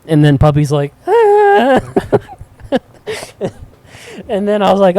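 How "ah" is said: loud high yelp; dog yelp. Retold or imitated. Voicing the dog yelping again, loud high yelp. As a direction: cry out like a stung puppy.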